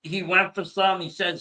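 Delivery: normal talking voice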